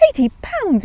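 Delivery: shocked exclamation. A narrator's reading. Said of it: "he exclaimed".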